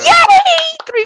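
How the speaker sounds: high shouted yay; excited cheer. Real, not acted. Cheering new followers.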